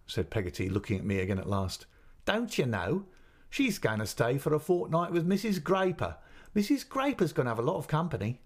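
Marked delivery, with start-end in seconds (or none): 2.2-8.4 Peggotty's voice. Acted. Peggotty explains brightly.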